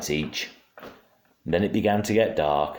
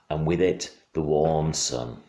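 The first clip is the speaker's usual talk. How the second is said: ordinary delivery, nothing marked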